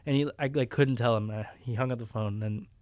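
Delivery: normal talking voice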